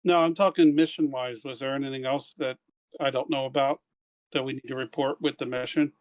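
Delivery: ordinary delivery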